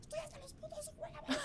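very high, strangled mock scream; slow screech at reduced volume, as if losing it; unhinged scream. Contestant snapping at the crew, unhinged mock scream. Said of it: "empiezo a gritar totalmente deschavetado".

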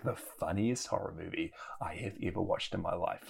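whispered aside. Real, not acted. Praising the movie to Micah in a whisper. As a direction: hush it like a secret.

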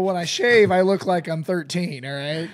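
squeaky, breaking voice. Real, not acted. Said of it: "My voice is squeaky right now".

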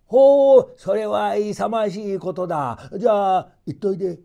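put-on an impressed grandfather sending the boy off; a touch of a pleased old man. A narrator's reading. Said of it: "とおじいさんは言いました".

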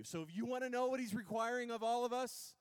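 ordinary delivery

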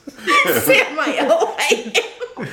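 high, loud reading collapsing into giggles; can't get through it for laughing; breaking up laughing. Real, not acted. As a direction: try to read but crack up helplessly. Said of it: "I can't do it".

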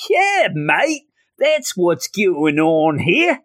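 put-on drawn-out, high Australian accent. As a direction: stretch the vowels in a broad mock Aussie. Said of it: "I sound Australian".

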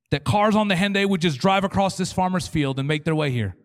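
loud, chanting mock prayer; fervent Pentecostal prayer. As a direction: keep pleading in the hammed-up prayer voice. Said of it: "We pray these prayers".

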